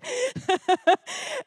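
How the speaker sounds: squealing laugh at the story; high burst of laughter. Real, not acted. Preacher laughing at the movie scene, high squeal. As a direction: crack up high.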